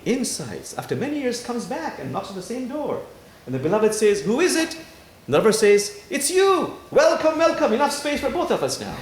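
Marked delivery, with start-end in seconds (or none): 4.2-4.8 high, loud call through a door. Retold or imitated. Beloved calls through door again. 6.1-9.0 high, loud and jubilant; joyful welcome. Retold or imitated. Lover answers, beloved joyfully welcomes.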